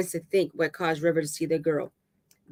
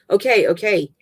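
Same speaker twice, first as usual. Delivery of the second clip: loud, higher-pitched double okay; urgent placating; 4 semitones higher, 7 dB louder than usual. Acted. Dave holding on to River, urgent placating. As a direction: cut in to placate.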